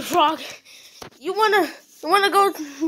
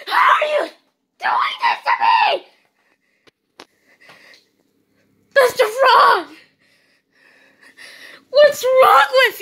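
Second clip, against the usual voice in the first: high, loud frightened cries; panicked yelling; 8 semitones higher, 3 dB louder than usual. Acted. Owner panicking at the armed frog, crying shouts. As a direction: scream in terror with sobs and gasps.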